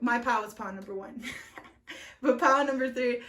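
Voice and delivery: ordinary talk, nothing marked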